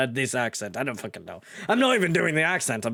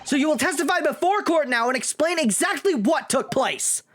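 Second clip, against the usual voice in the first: much higher, eager defense attorney; earnest young lawyer; 11 semitones higher than usual. Acted. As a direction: press the witnesses hard. Defense lawyer presses witnesses.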